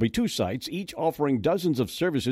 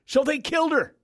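shocked disbelief; 13 semitones higher than usual. Real, not acted. Shocked host reacting to news story.

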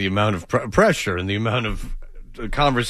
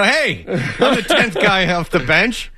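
slow, higher, laughing brag; mock benchwarmer boast; 6 semitones higher, slower than usual. Mocking the tenth man on a bad team, slow and laughing. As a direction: drag out a smug boast through laughter.

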